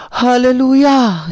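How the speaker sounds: high, loud, slow hallelujah of relief; slow, high, loud joyful praise; relieved hallelujah. Acted. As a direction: draw it out in grateful relief. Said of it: "sabi niya".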